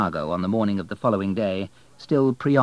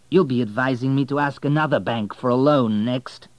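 sarcastic; 5 semitones higher, 7 dB louder than usual. Acted. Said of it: "said Abel sarcastically".

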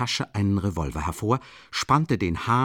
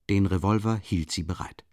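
ordinary delivery

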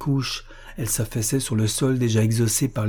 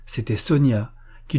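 ordinary delivery

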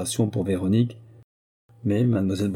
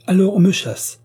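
curt, much higher and louder outburst; curtly; 11 semitones higher, 8 dB louder than usual. Acted. Dismissed servant retorting to the prince, curtly. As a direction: spit it out curtly. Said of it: "fit sèchement Véronique".

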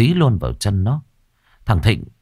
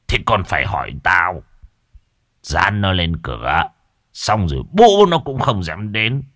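put-on old master dismissive. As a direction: brush off his fear. Master reassures and instructs.

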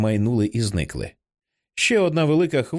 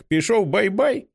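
put-on high, slow child's voice, rising on "бай-бай"; a toddler asking sweetly; child's question; 11 semitones higher, slower than usual. A narrator's reading. Little son asks if the lighthouse went to sleep, high and slow. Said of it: "запитує Джек".

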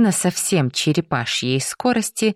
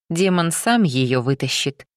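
usual speaking voice

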